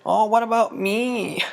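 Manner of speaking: high, drawn-out whiny plea; mock whining. Banquo wants a prophecy too from the witches, high mock whine. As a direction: whine it like a left-out friend. Banquo butts in wanting attention.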